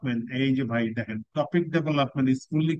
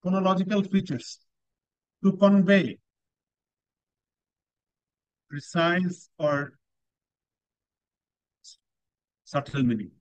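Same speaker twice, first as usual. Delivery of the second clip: slow, with long pauses between phrases; teacher reading criteria aloud; very slow descriptor reading; much slower than usual. Shown as a demonstration. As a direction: lay each phrase down one at a time.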